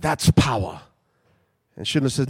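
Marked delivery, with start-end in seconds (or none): 0.0-0.8 put-on German accent. Repeating the German officer's line, heavier German accent. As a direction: lower German-accented that's power, said again more firmly. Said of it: "It's a German accent".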